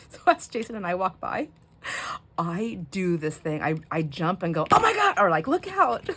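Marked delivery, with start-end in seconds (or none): 4.7-6.1 much higher, louder fake-panic yelp; mock scare. Pranking the stockers as she walks by, hammed-up fake-panic shriek. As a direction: jump and shriek like the shelves are collapsing.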